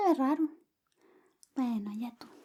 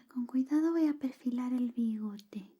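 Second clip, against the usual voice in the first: whisper; 4 semitones lower, 3 dB quieter than usual. Acted. ASMR whisper roleplay.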